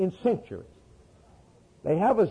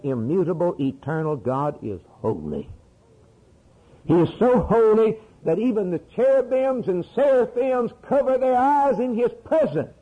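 ordinary talk, nothing marked

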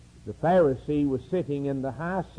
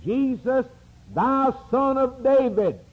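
higher, raised call; 8 semitones higher than usual. Retold or imitated. Blind beggar hailing Jesus from the roadside, calling out. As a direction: call out across the crowd.

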